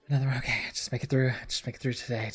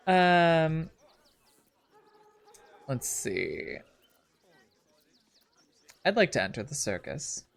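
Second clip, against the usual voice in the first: usual speaking voice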